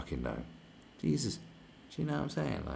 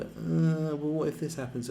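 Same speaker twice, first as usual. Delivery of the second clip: higher, mock whiny hesitation; mocking timid whiner; 9 semitones higher than usual. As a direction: imitate a fretting coward, pitched up. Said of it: "like a bunch of pussies going".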